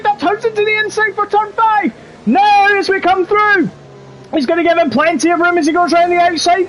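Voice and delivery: high, fast, urgent play-by-play; live race commentary. Acted. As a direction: call the race as it unfolds.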